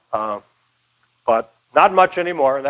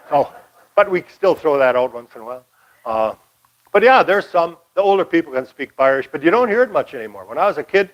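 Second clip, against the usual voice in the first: usual speaking voice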